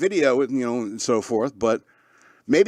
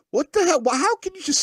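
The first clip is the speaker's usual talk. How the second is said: a much higher "what the hell"; incredulous; 13 semitones higher than usual. Real, not acted. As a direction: pitch up in disbelief. Incredulous at franchise wars.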